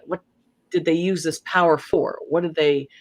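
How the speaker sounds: usual speaking voice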